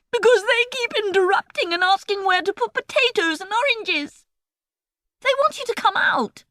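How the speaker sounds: put-on high girlish voice. A narrator's reading. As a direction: voice Emily, high and young. Emily voiced in a story.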